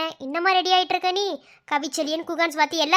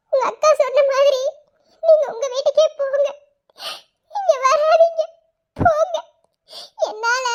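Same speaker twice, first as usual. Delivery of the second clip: higher, louder sobbing cry; crying outburst; 8 semitones higher, 4 dB louder than usual. Acted. Girl crying loudly, sending her lover home. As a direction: push him away through tears.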